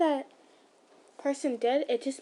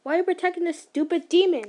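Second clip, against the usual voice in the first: raised, higher and louder accusation; angry demand; 5 semitones higher, 5 dB louder, faster than usual. Acted. A stranger scolding him for shielding a demon, angry. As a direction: throw the question at him in contempt. Stranger angrily confronts the slayer.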